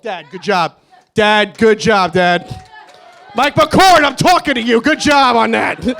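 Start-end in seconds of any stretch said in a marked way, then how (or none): 1.1-2.7 louder repeat to get Dad's attention; calling out. Acted. Son congratulating his dad, calling out louder. 3.3-5.9 high, loud call across the room; shouting to someone. Acted. Speaker calling Mike McCord to congratulate him, shouting. Shouts to get dad's attention.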